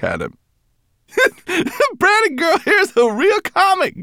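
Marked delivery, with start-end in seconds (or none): 1.1-1.9 high-pitched, helpless laughter; hearty laugh. A narrator's reading. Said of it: "laughed so heartily". 1.9-4.0 high, laughing gasp; gasped through laughter. A narrator's reading. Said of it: "gasped Berber Chan".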